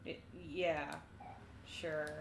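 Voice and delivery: hesitant quiet. Acted. Aubrey answers hesitantly, quietly.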